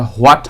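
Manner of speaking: "হোয়াট" with a sounded H, ho-at; wrong pronunciation. Shown as a demonstration. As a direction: open "হোয়াট" on H before the glide. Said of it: "এটা হোয়াট হবে না".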